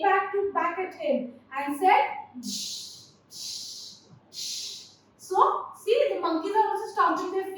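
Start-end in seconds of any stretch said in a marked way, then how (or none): 2.4-4.8 three soft voiceless hisses; monkey shushing noise. A narrator's reading. Monkeys answering the peddler, mocking hissed shushes. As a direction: imitate monkeys with short separate shushes. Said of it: "This is the noise that the monkeys are making".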